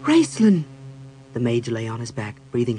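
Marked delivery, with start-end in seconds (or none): 0.0-0.6 high, loud call of his name; startled cry. A narrator's reading. Cleric sees the mage by the light, crying out.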